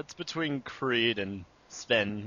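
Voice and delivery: usual speaking voice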